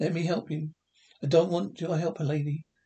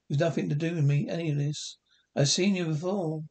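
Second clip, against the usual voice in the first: normal talking voice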